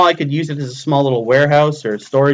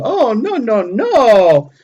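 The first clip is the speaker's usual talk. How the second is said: imitating Mara; 7 semitones higher, 3 dB louder, slower than usual. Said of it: "I hear Mara going".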